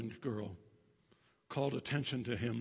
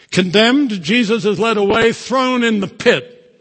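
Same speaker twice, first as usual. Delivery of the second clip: much louder, high, emphatic preaching; preaching at full force; 9 semitones higher, 20 dB louder than usual. Acted. Preacher proclaiming the book's words to the congregation, loud emphatic. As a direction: thunder the quote to the congregation.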